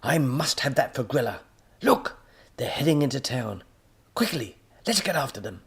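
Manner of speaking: high, loud excitable professor; high cackling-edge professor voice; mad professor voice. Acted. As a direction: play the wild-eyed villain scientist. Professor urging robot on.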